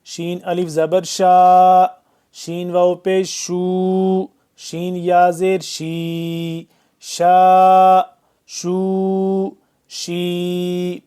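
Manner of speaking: clear, deliberate شا شو شی; teacher modeling each sound; syllable drill. Shown as a demonstration. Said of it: "teacher drills letter syllables".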